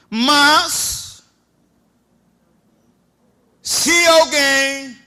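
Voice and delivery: loud, slow, high scripture reading; preacher proclaiming a Bible verse; preached scripture. Acted.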